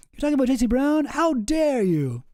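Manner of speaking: mock indignation. Mocking Jesse's indignation.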